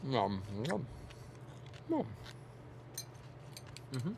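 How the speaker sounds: chewing while talking; eating chicken with mouth full. Real, not acted. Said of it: "I'm gonna take a bite".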